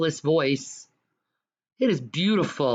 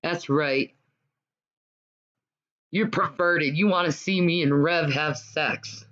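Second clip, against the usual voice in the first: ordinary talk, nothing marked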